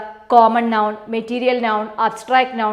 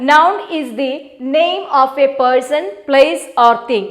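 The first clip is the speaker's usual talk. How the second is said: slow, word by word, for notes; slow dictation; much slower than usual. Shown as a demonstration. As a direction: dictate the definition slowly. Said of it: "ഇപ്പൊ noun എന്താണ്?".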